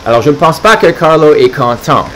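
ordinary delivery

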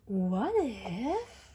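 ordinary delivery

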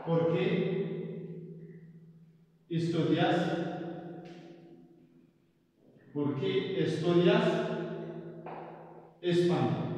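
long pauses between chunks of the question; piece-by-piece dictation. Shown as a demonstration. Teacher dictating "por qué estudias español" to students, chunk by chunk. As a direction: break the question up for learners to copy.

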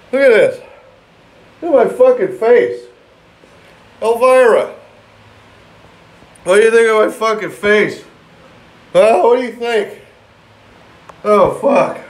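high, loud strained yelling in pain; loud pained shouting. Real, not acted. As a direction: yell through the burning pain. Burning pain, showing his face.

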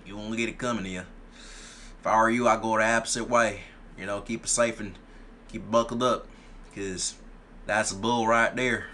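playful put-on Southern accent. Streamer warning players to go the opposite way, Southern accent. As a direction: warn them in a mock Southern accent. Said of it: "Might have to use my southern accent".

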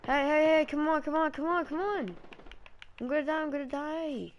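high frantic pleading; panicking gamer. Real, not acted. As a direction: yell for help as you go down. Dying, begging teammate.

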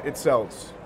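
normal talking voice

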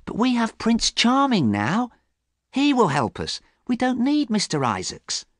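high-pitched, confident character voice; hopeful young Sybil. Acted. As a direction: answer brightly, pitched well up.

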